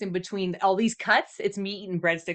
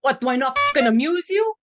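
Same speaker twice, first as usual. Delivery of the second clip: loud, higher mock tough-guy challenge; mock-menacing gangster; 5 semitones higher, 8 dB louder than usual. Co-host teasing listeners who don't comment, mock-menacing gangster. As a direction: play the affronted mobster for laughs.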